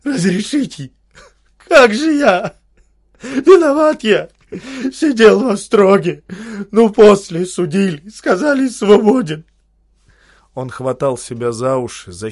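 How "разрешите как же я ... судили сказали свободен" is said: high, loud, choking on the words; kneeling man wailing a broken confession; choking cries. Acted. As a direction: cry out in a voice not your own. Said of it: "стал кричать не своим голосом, давясь словами".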